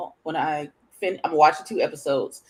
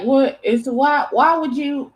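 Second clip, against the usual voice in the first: higher-pitched mock bafflement; upset disbelief; 9 semitones higher, 4 dB louder, slower than usual. Upset viewer questioning the episode, baffled disbelief. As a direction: replay your stunned protest at the screen.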